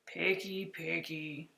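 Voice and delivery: low, quiet grumble of a fussy monster; grumbling complaint. Acted. Monster Herbert grumbling at the picky boy, low and muttered. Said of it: "Herbert complained".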